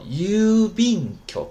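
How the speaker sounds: 邮便局 high, loud and slow, first vowel drawn out; long vowel. Shown as a demonstration. Teacher previewing a lesson two word, 邮便局 with long vowels. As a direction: stretch 邮便局 out in Japanese pronunciation. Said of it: "它就发成长音了".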